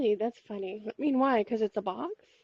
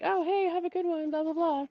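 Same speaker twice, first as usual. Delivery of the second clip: high, cheerful mock friendliness; breezy airport staff; 7 semitones higher than usual. Airport staff waving her through, mock cheerful voice. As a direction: toss it off like a bored but friendly agent. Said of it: "they were like".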